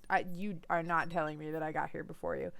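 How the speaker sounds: ordinary delivery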